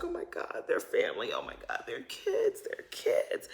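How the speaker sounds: soft, breathy mock-tearful whisper. Heather crying about the kids, soft teary whisper. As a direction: whisper it like you're choking up.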